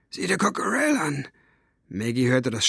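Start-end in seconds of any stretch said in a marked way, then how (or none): 0.1-1.3 high, rough villain's voice; rough cat-tongue voice. A narrator's reading. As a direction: rasp it like a cat's tongue. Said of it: "die Katzenstimme". (raue, hohe Katzenzungenstimme)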